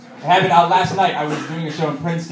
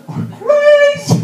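squealed emphasis; 9 dB louder, much slower than usual. Acted. Punchline about LA homeless.

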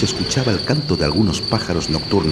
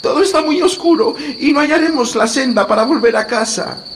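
put-on tearful little girl; 5 dB louder than usual. A narrator's reading. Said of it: "Gretel se puso a llorar".

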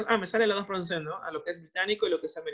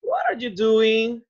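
slow, drawn-out question, the second one louder; slow model question; 4 semitones higher, 5 dB louder, much slower than usual. Shown as a demonstration. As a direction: stretch the question out for the class. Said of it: "¿no?".

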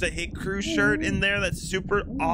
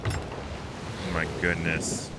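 slow, far softer and lower breathy-free murmur; hushed dismay; 6 dB quieter, much slower than usual. Real, not acted. Staring at the ghost ships, hushed slow dismay. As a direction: let it drain out of you, barely voiced.

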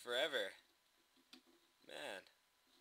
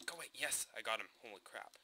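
ordinary talk, nothing marked

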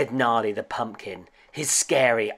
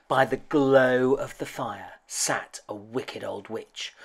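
ordinary delivery, nothing marked

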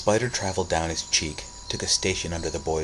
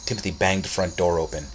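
ordinary delivery

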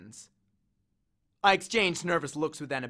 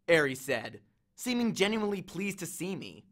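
ordinary delivery, nothing marked

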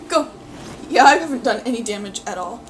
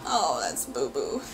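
low silly voice; 11 semitones lower, 6 dB quieter, slower than usual. Real, not acted. Sees character.

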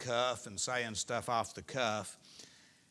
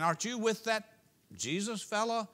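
higher suspicious question; accusing bystander; 8 semitones higher than usual. Acted. Bystander at the campfire challenging Peter, higher and suspicious. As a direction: poke at him like a nosy stranger.

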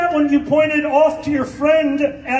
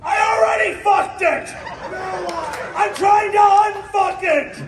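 exasperated shouting, higher; frustrated yell; 5 semitones higher than usual. Acted. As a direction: blow up at the mechanic. Frustrated customer yelling at mechanic.

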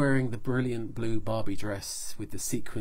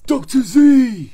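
booming, slow and much louder; big voice; 16 dB louder, slower than usual. Acted. Action Man hailing Doctor Z in his big voice. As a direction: call the name out big and hearty. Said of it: "he says in his big voice".

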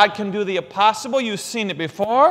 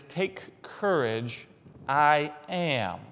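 gentle reassurance; 4 semitones lower, 6 dB quieter, much slower than usual. Acted. Tender quoting of Jesus.

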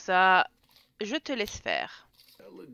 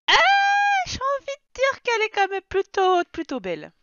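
excited exclamation; 9 semitones higher, 7 dB louder than usual. Real, not acted. Admiring a strange creature.

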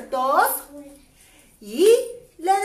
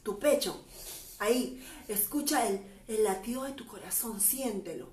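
quiet, gentle guidance; soft voice; 3 semitones lower, 13 dB quieter than usual. Real, not acted. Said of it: "guiding kids softly". (voz suave y tranquila)

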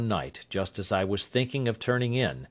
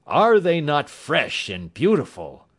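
louder, slow, delighted exclamation; enthusiastic cry; 6 semitones higher, 5 dB louder than usual. A narrator's reading. Watson delighting in the countryside to Holmes, louder and slow. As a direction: savor the fresh spring air aloud. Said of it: "I cried with all the enthusiasm".

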